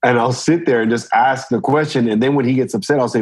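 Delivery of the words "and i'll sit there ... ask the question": usual speaking voice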